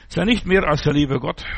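ordinary talk, nothing marked

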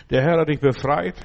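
ordinary talk

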